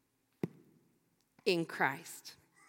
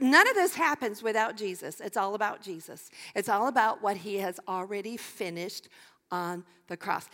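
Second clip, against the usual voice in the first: ordinary talk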